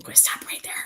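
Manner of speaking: voiceless whisper, cutting yourself off; whispered self-check. Real, not acted. Cuts herself off mid-remark.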